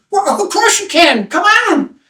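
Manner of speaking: very high, drawn-out invitation; welcoming fellow traveler. Acted. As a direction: wave him in with a high call. Line member welcomes him.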